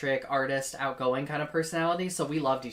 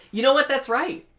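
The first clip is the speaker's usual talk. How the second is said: emphatic agreement; 10 semitones higher, 8 dB louder than usual. Retold or imitated. Retells own emphatic agreement to insult.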